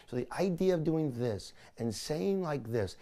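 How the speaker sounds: normal talking voice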